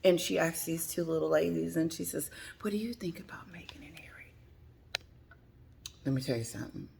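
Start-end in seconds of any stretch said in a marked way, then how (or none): 2.6-4.3 hushed, fast, breathy whisper of the question; whispered quote. Retold or imitated. Reporter asking two ladies, retold in a whisper. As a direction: whisper it like a secret.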